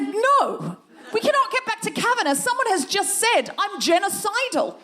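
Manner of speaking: high, insistent refusal; high-pitched indignation. Retold or imitated. Comedian refusing the presenter's change of subject, high-pitched indignation. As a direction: refuse point-blank, voice climbing in outrage. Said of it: "I got a bit high-pitched".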